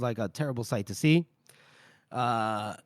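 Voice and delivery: usual speaking voice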